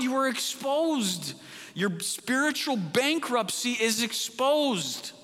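fervent preacher's rebuke, pitched high; preaching at full force. Acted. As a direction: thunder it from the pulpit like a prophet. Preaching Jesus' rebuke continues.

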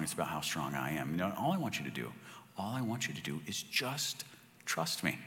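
a touch of a hushed, fatherly God; God speaking. Retold or imitated. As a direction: speak as a gentle God reassuring Moses. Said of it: "And he says".

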